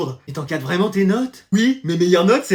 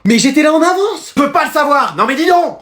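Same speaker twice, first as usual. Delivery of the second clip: high, raised-voice protest; indignant quarrel; 7 semitones higher, 4 dB louder than usual. Acted. Pupil and teacher quarrel over a late slip, indignant. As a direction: argue back loudly and high.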